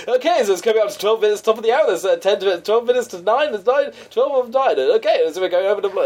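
radio DJ lightning round. Retold or imitated. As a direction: imitate a fast-talking radio host. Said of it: "his lightning round routine".

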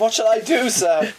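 high-pitched mock pleading caller; imitated scammer. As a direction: mimic the caller's polite question up high. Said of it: "He said".

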